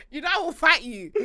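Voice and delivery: ordinary talk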